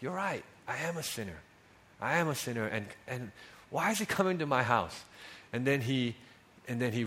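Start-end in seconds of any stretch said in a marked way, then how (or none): 0.0-5.0 softer, lowered confession of guilt; humble admission. Pretend. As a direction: quietly concede you are a sinner. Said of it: "humbles himself and says".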